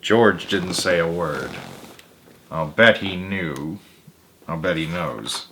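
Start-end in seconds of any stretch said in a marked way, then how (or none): none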